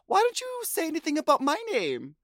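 Ellie asking Ru. Retold or imitated. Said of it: "asked Ru".

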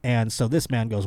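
ordinary talk, nothing marked

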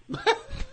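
high giggle. Real, not acted. High laugh.

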